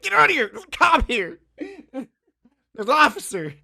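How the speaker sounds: high, loud, laughing panic yell; frantic yelling. Retold or imitated. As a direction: yell half-laughing that a cop is at the door. Panicked yelling to roommate about cop.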